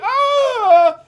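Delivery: high hammed-up shriek; shrill pretend scream; mock scream. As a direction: let out a fake panicked yell.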